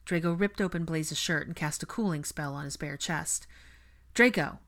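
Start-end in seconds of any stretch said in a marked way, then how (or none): 4.1-4.6 high, loud urgent call. A narrator's reading. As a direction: call his name as you rush in. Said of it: "Ginny called".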